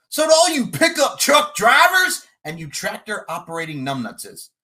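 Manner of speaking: loud promo shout. Acted. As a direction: yell it at the crowd. Wrestling promo insult.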